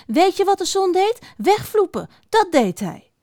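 high-pitched indignant grumbling; verontwaardigd mopperen. Acted. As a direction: complain about the runaway sun as a fox. Vos klaagt verontwaardigd over zon. (hoog en verontwaardigd mopperend)

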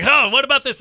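naive question. Retold or imitated. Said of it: "I'm like".

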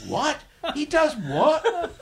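high, slow, disbelieving with a laugh; incredulous. Retold or imitated. Squadron mates hearing the umbrella story, high slow incredulous. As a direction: stretch it out in mock disbelief. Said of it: "they all said".

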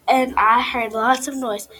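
loud, slow, annoyed; bully shark grumbling loudly about the noise; annoyed shout. Acted. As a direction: stamp each word out in complaint. Angry bully explaining.

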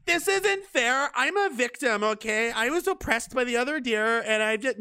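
high-pitched mock whine; whining victim deer. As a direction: whine in protest like a self-pitying victim. Voices a deer claiming victimhood.

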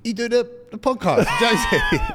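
very high, squeaky mock fan; starstruck fan voice. A gym stranger asks about the podcast in a high squeak. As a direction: squeak it up like a giddy admirer.

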